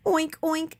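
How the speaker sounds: lightly voiced playful oinks; a cheeky oink oink; pig oinks. A narrator's reading. The pig taunting Eugenia while running circles, playful oinks. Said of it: "said Mercy".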